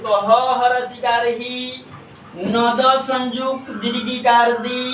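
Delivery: slow spelling-out of हिंदी in two parts; हिज्जे करके उच्चारण. Shown as a demonstration. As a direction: stretch ही, pause, then नद संयुक्त दीर्घिकार दी. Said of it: "तो इसे पढ़ेंगे कैसे". (खींच-खींचकर हिंदी का हिज्जे)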